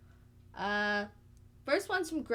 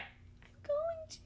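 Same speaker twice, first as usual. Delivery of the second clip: high, drawn-out, softer protest; protesting whine; 7 dB quieter, much slower than usual. Real, not acted. Co-host answering the order to read, high drawn-out protest. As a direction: stretch it out in a high exasperated whine. Protesting after being shouted at.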